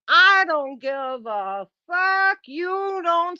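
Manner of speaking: slow, drawn-out insistence; emphatic drawl. Real, not acted. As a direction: stretch every word for emphasis. Insisting in an argument.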